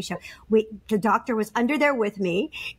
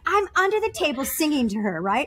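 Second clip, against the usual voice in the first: ordinary talk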